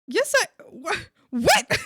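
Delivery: high-pitched indignant disbelief; annoyed. Retold or imitated. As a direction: sputter in offended disbelief, re-enacted. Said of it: "I got real annoyed at her".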